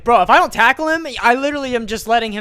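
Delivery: high, loud, fast self-talk; fired-up inner voice. Retold or imitated. Deciding in the moment to tackle the kid, high and rushed. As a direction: talk yourself into it, rushing. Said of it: "I was like".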